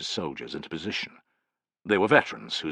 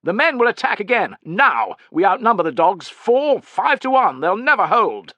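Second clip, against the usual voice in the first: very high, loud haughty tirade; loud, worked-up commander; impatient cry; 13 semitones higher, 7 dB louder than usual. A narrator's reading. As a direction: overrule them at a raised pitch. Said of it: "cried Tubero".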